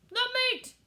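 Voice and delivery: very high, loud roar. A narrator's reading. Crew roars for meat, high loud roar. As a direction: roar it out together. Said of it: "we all roared".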